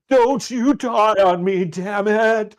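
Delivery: high, loud, drawn-out dramatic plea; hammed-up desperate shout over a dying man; frantic rescuer. Shaking the man by the collar, pleading frantically. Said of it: "I started shaking him".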